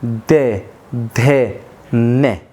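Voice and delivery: "दे, धे, ने" each syllable said apart with the tongue on the teeth; dental syllables drilled. Shown as a demonstration. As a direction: sound out "दे, धे, ने" one by one. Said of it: "आप उच्चारण करिए".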